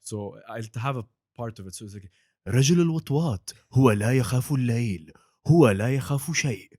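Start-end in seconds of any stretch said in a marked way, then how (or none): 2.5-6.7 fast, louder Arabic trailer announcer; Arabic sports channel promo. Shown as a demonstration. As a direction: rattle it off like a TV promo voiceover. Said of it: "which is the Batman, The Dark Knight trailer".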